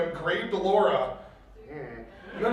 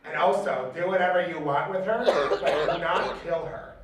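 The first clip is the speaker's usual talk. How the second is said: cold rasping evil emperor; 4 semitones lower, 3 dB quieter than usual. Acted. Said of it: "Then the Dark Lord said".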